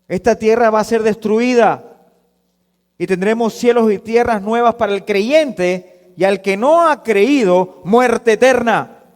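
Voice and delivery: loud, emphatic pulpit declaration; forceful preaching. Acted. Preacher warns of destroyed earth and eternal death, forceful. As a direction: drive the warning home loudly.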